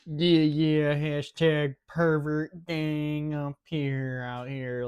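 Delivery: loud, high goofy hype; mock hype voice. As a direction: hype it up like a bro. Mocking the characters.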